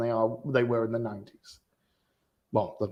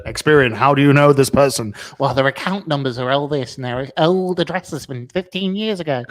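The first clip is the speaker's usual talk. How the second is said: louder mock call-centre agent; Experian call-centre voice; 6 dB louder than usual. Experian agent quizzing a caller about someone's account, mock officious. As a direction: interrogate like a credit agency phone operator. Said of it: "Why do Experian talk like that".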